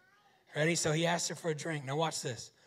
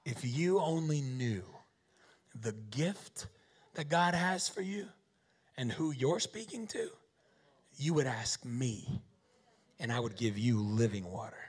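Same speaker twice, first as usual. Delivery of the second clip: calm Jesus voice; 5 semitones lower than usual. Acted. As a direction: offer it tenderly. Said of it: "Jesus says".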